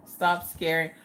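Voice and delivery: normal talking voice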